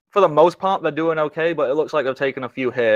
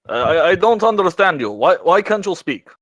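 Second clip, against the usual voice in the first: higher-pitched, baffled character; familiar character voice; 6 semitones higher than usual. Acted. Character questioning a bat that cannot speak, baffled character voice. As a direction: plead in a high character voice. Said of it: "a very familiar voice going".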